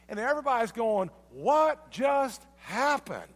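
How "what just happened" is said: stunned crowd. Retold or imitated. Crowd stunned by healed demoniac.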